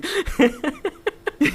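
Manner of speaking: real high burst of laughter. Real, not acted. Narrator cracking up at the scene, a high laugh.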